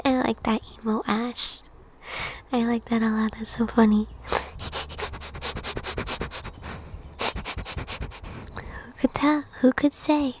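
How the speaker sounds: gentle breathy whisper to viewers; ASMR whisper. Acted. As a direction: whisper it like a bedtime ritual. Whispering reactions to chat.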